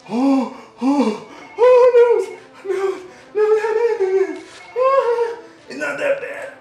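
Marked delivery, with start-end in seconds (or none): 0.1-5.4 high, loud panicky yelps from the cold; panicked cold yelps. Real, not acted. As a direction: squeal at the icy shock. Plunging into ice water.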